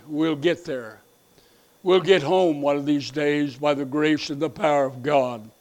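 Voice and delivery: drops to a soft, low hush; quiet reassurance. Real, not acted. Pastor softly promising the church they'll get home. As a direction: comfort them quietly, close to the mic.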